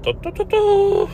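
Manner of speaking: falsetto do do do do; playful high do sounds; high sung do do. Shown as a demonstration. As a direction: chirp the do's up high. Imitating input, high.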